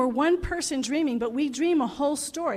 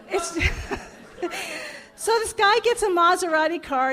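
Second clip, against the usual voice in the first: high laughing start to the joke; giggling into the setup; 7 semitones higher, 6 dB louder than usual. Real, not acted. Laughing as she starts telling the congregation a joke. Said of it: "I'm terrible at telling jokes".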